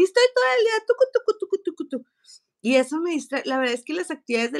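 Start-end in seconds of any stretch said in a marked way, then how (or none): 0.0-2.1 high, loud and very fast; frantic rushing. Retold or imitated. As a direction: rattle it off like scurrying. Mimics rushing around all day. (describiendo su día, acelerada y frenética)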